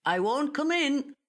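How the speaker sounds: high, light call across the yard; a postman calling out from the gate. A narrator's reading. Postman calling to Joe from the gate, high called-out voice.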